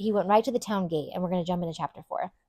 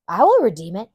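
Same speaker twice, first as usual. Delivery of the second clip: eager reply; 11 dB louder than usual. Retold or imitated. Redeemer's eager reply, voiced.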